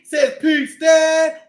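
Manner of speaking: ordinary delivery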